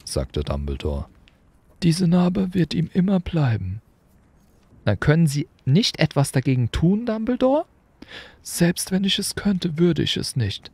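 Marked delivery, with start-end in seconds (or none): 1.8-3.8 put-on high, kindly old wizard, lightly curious; an amused old headmaster asking; Dumbledore. A narrator's reading. Dumbledore questioning Hagrid about the motorbike, amused. 4.9-7.7 put-on much higher, brisk, stern old witch; a crisp teacher's question; McGonagall. A narrator's reading. McGonagall checking on Hagrid, crisp and stern.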